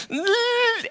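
high, drawn-out frustrated ugh; frustrated groan. Retold or imitated. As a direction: groan like you're left out of the joke. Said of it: "I'm just like".

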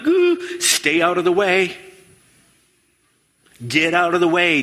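high, urgent warning to scatter; mock alarmed warning. Kids warning each other as angry dad comes home. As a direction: warn the others to hide, half-playful. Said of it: "everyone's like".

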